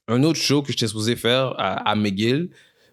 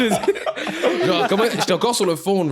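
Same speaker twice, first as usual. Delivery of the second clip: laughing; 9 semitones higher, slower than usual. Real, not acted.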